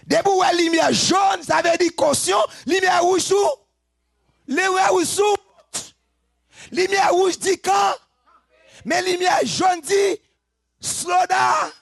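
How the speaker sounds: high, loud shouted preaching; fiery preaching. Acted. Preacher shouting to the church, high and loud. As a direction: drive the traffic-light lesson home at full volume.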